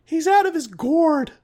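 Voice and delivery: high, strained mock Larry voice; horrible Chris Elliott impersonation. Podcast host mocks Chris Elliott's gourd line, high silly voice. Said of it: "that's a horrible Chris Elliott impersonation".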